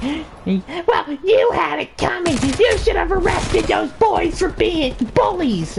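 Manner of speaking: very high, indignant mom voice; mock outraged screech. Mother defends throwing ball at cop, mock outraged screech. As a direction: imitate a shrill mother blaming the cop.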